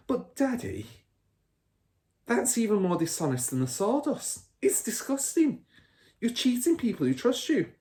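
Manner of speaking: put-on a high, disapproving little girl; a little girl scolding her father; indignant girl. A narrator's reading. Matilda voiced high.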